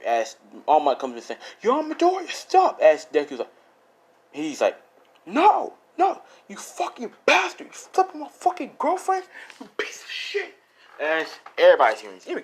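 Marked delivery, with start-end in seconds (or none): 1.6-2.8 high, hammed-up command; mock urgent shout. All Might arriving to stop Deku, mock urgent shout. 5.3-10.5 high, strained, hammed-up rant; acted enraged yelling. Deku to the guy who slept with his girlfriend, acted rage.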